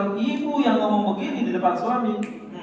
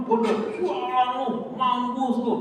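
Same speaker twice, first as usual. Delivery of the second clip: hammed-up scolding, pitched well up; mock angry shouting; 7 semitones higher than usual. Preacher imitating an angry person cursing, mock angry. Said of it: "kalau orang marah harus ngomongnya".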